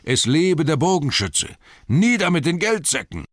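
rally the far crowd; rallying shout. Acted. Distant townsfolk take up the cry, loud rallying shouts. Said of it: "das Geschrei".

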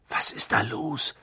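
unvoiced, tense whisper in the dark. A narrator's reading. Ron whispering to his friends in the sudden darkness. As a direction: breathe it out, afraid to be heard.

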